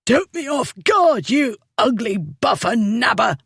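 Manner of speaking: put-on high, winded drunkard's gasp; gasping drunk. A narrator's reading. The winded drunkard cursing the Duke, high and gasping. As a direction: gasp it out after the punch. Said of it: "the drunkard gasped".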